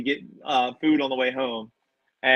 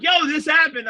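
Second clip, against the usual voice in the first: excited friend; 5 dB louder than usual. Retold or imitated. Friend excitedly reporting match.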